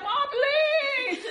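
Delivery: loud high crying plea; begging child. Retold or imitated. Boy crying to his mom through the door, loud high wail. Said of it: "I'm like".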